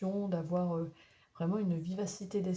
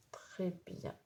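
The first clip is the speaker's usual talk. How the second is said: whisper; 7 dB quieter than usual. Real, not acted. Aside after reading card.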